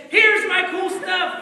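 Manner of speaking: high, loud, slow showing off; boastful king. Acted. King showing off his treasure to guests, loud and proud.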